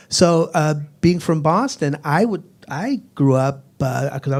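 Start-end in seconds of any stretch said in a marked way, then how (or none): none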